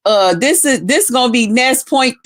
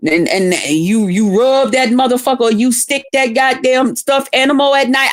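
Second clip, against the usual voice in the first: usual speaking voice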